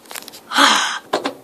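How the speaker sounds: loud breathy sigh. Real, not acted. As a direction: heave a big sigh.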